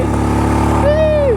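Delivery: high whooping cheer; excited whoop. Real, not acted. Cheering on the scooter Dreamy up the hill, high excited whoop. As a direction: whoop with delight as the bike climbs.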